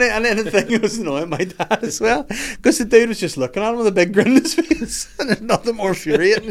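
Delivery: high, loud, laughing delivery; laughing while talking. Real, not acted. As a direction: tell it while cracking up. Cracking up at own story.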